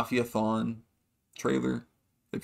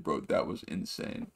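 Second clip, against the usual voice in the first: lower, whispered disbelief; soft mock awe; hushed awe; 6 semitones lower, 8 dB quieter than usual. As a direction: whisper it in shock. Los freaking out after picture.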